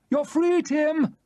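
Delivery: a delighted farewell yell; joyful shout. Acted. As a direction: shout it across the widening water.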